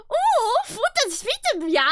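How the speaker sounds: high, loud mock German accent; Koenig. Imitating his accent for laughs, mock German accent. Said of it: "he does sound like".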